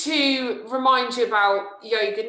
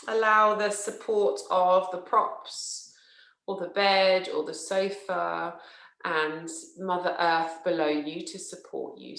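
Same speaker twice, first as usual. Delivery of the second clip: slow, measured yoga nidra voice with long pauses; guided relaxation; slower than usual. Acted. Teacher settling listeners into yoga nidra, slow guided relaxation. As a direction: guide them unhurriedly into rest, leaving space after each phrase.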